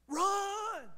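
sharp high shout; yell. Retold or imitated. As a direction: shout it out to get moving. Yelling at himself to run.

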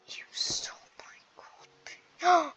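mock-horrified breathy whisper; shocked whisper. Player accuses friend of stealing gold pants, shocked whisper. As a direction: gasp it out in a scandalized whisper.

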